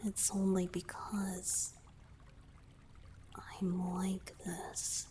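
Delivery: soft breathy whisper close to the ear. Acted. Merman confiding to his savior in a whisper. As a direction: whisper it like a confession.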